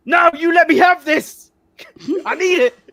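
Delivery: loud, high plea to the fan; high pleading shout at the Falcons fan; mock desperate yell. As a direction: yell it like you need this win. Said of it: "I scream across him".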